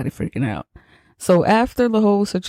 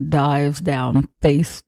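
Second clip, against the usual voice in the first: usual speaking voice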